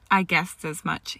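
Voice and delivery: usual speaking voice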